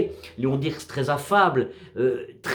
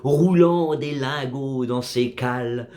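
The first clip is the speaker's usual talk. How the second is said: slow, solemn verse reading with measured pauses; poem recitation; 3 semitones lower than usual. Acted. Narrator recites a sea poem about a drifting wreck, measured recitation. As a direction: recite it as poetry, line by line, falling at the ends.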